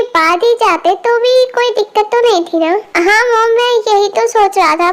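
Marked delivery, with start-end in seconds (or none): none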